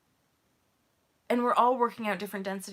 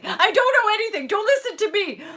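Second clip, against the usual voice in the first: high, loud, panicky wail; panicked; 12 semitones higher, 9 dB louder than usual. Retold or imitated. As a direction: plead with them not to listen. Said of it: "and I'm like".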